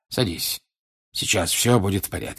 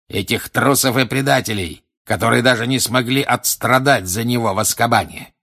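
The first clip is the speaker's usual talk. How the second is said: louder, higher, mounting rant; contemptuous tirade; 3 semitones higher, 4 dB louder than usual. Acted. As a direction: spit contempt at the cowards. Villain rants about cowards. (гневная презрительная тирада)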